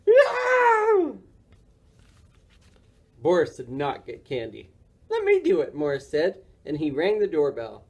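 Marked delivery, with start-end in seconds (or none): 0.1-1.2 very high, loud, drawn-out shriek; frightened yell. A narrator's reading. Lady seeing Boris at her door, shrieking. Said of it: "she yelled". 5.1-5.7 very high, eager little character voice; eager Morris voice. A narrator's reading. Morris taking over the doorbell from Boris, high eager voice.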